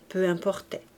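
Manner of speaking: plain reading voice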